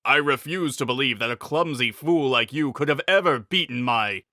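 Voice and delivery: lightly voiced arrogant knight cut off mid-word; lightly voiced scornful knight. A narrator's reading. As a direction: taunt him as a fool. Rebanok voiced in story.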